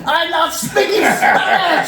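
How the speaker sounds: high, slow mock shout in thick accent; hammed-up exasperated yell, accented; elevated voice. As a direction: shout it word by word, imitating exasperation. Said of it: "his voice is a little bit elevated".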